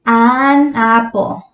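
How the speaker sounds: "an apple" drawn out slowly, each word clear; slow model of "an apple". Shown as a demonstration. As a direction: spell out "an apple" for the class. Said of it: "entonces usamos".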